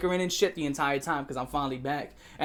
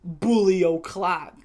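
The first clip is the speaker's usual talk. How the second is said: drawn-out, punched announcement; slow, emphatic proclamation; loud catchphrase call; 4 dB louder, much slower than usual. Retold or imitated. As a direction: stretch it out like a taunting catchphrase. Acting out his old catchphrase.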